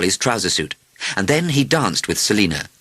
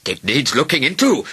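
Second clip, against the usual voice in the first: higher-pitched, officious declaration; declaring optometrist; 6 semitones higher than usual. A narrator's reading. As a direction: declare it like a concerned specialist. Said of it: "declared an optometrist".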